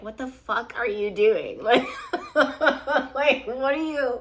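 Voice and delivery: incredulous question dissolving into laughter; baffled, laughing too hard to finish; laughing disbelief. Acted. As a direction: stretch it out slowly, then crack up. Cracking up while voicing baffled partner.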